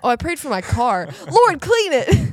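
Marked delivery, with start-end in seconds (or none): 1.3-2.3 high, slow mock prayer. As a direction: pray over the car with hammed-up solemnity.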